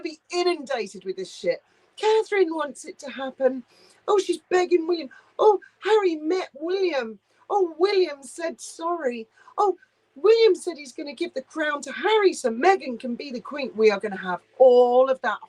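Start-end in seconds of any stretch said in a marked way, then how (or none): 2.0-13.7 higher, sing-song mockery of gushing tabloid news; mock-gasping higher voice, overdone sympathy; sarcastic mock headlines. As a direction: parody each breathless headline. Mocking the coming puff-piece headlines.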